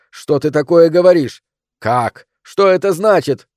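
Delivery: loud, high furious questioning; lashing out. A narrator's reading. Husband demanding his wife explain herself, lashing out. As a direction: fire angry questions at her. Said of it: "набросился на неё Джеймс".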